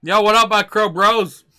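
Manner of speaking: loud, bro-style greeting to crows; talking in Crow. Pretend. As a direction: hail the crows like your buddies. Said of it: "talk to them in Crow".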